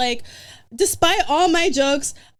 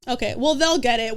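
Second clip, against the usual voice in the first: ordinary talk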